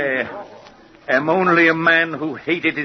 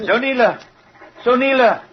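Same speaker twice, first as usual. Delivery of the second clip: calling out; 11 semitones higher than usual. Acted. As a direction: summon her from afar, harshly. Stepfather calls daughter across street.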